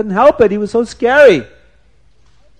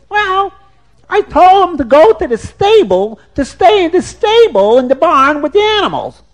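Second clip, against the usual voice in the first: shrill woodcutter's wife; 12 semitones higher, 7 dB louder than usual. Acted. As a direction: shoo the stranger off in a high, loud voice. Woman voice in told story.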